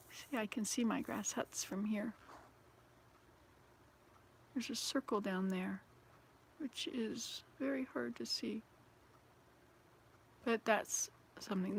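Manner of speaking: hushed whisper at the window; soft whispered look outside. Real, not acted. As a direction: whisper so the outside stays undisturbed. Whispering while looking out window.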